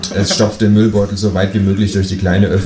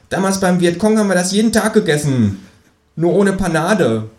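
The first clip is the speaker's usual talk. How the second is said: high, cheeky kangaroo voice; 9 semitones higher than usual. Acted. To the narrator at dinner, high cheeky kangaroo voice. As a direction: pipe up as the smug kangaroo. Känguru voice.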